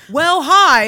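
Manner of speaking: overly bright TV-news "Well, hi"; mock news anchor. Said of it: "news be like".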